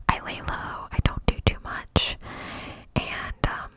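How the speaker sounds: ordinary talk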